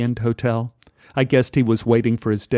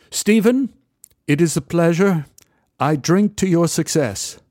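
a polite English gent's greeting; English accent; 6 semitones higher than usual. Retold or imitated. English stranger greets narrator at the bar, lightly English. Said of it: "He, he was English".

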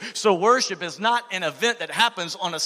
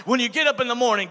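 quick aside; 5 semitones higher, faster than usual. Real, not acted. Sermon point, hurried.

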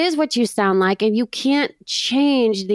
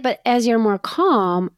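ordinary talk, nothing marked

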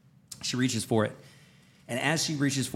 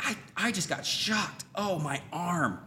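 slow, high, stunned whimper; imitated pain; 6 semitones higher, 5 dB quieter, slower than usual. Husband imitating his shocked wife in pain, drawn out. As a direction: drag it out, hurting and shocked. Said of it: "she immediately is in pain".

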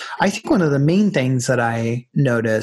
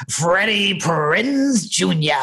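slow, drawn-out imitation of a come-on; higher, drawling impression of a guy at Pride; Telly Savalas voice; 4 semitones higher, much slower than usual. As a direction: stretch each word like a slick admirer. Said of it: "had that voice".

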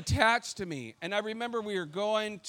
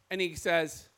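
ordinary delivery

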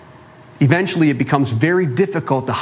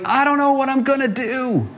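high, cracking desperate plea; breaking down; 10 semitones higher than usual. Acted. Widow breaking down before Elisha, high desperate plea. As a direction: let the voice climb as she falls apart. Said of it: "she just breaks down".

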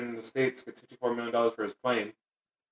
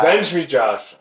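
loud, higher, hammed-up dying cry; overacted death scene; 5 semitones higher, 10 dB louder, much slower than usual. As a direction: ham up a last plea for vengeance. Mock dying plea for revenge.

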